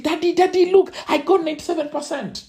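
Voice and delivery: put-on high, eager child's voice; a boy showing off his grade to his dad; excited child. Boy proudly showing his dad the grade, high and eager.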